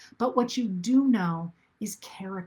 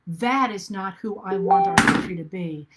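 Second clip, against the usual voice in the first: louder, firm refusal; firm declaration; 7 dB louder than usual. Retold or imitated. Telling the country what it refuses to become, louder and firm. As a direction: plant your feet and say it outright. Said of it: "but rather say".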